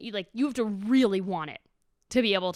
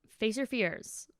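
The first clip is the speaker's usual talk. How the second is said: normal talking voice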